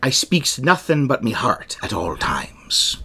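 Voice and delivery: put-on pirate speech. Reading a film quote to co-host, pirate voice. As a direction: play a plain-spoken old sea dog. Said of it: "I'm gonna do a pirate voice".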